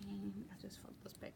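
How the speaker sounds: breathy whisper while folding fabric. Real, not acted. To herself, folding the stitching back, whispering. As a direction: murmur it under your breath.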